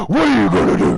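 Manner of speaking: ferocious, loud roared challenge; Batman voice. Retold or imitated. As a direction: do your biggest Batman, roaring it out. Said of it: "in my biggest Batman voice".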